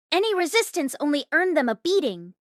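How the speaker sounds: usual speaking voice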